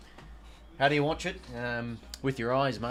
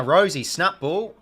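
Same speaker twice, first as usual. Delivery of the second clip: excited call; 5 semitones higher, 11 dB louder, slower than usual. Acted. Shot on goal in the call.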